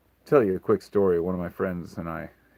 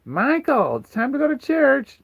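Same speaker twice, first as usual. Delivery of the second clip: high, loud mock-mom yell up the stairs; a mother screaming up the stairs at her son, imitated high and loud; 7 dB louder than usual. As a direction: mimic a mother screaming upstairs for church. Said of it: "she screams up the stairs".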